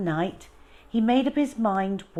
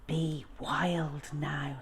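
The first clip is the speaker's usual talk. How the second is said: soft, slow whisper to the lion; a boy whispering goodbye to his lion; 6 dB quieter, much slower than usual. Acted. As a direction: breathe it slowly, barely voiced. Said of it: "he whispered".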